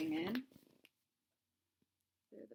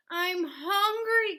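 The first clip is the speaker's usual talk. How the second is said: loud, higher and slow, a desperate wail; cried out; 9 semitones higher, 9 dB louder, slower than usual. A narrator's reading. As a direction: stretch out the hunger loudly. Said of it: "cried Cyril".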